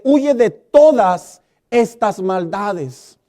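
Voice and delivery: "Huye de todas estas maldades" drawn out slowly; solemn slow quote of the verse; slow scripture reading. Shown as a demonstration. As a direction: read the verse slowly, weighing each word.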